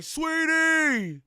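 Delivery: high, loud, drawn-out mocking holler; mock high call. Streamer imitating his viewers to the chat, mock high call. Imitates others calling out "Sweetie.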